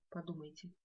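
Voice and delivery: ordinary talk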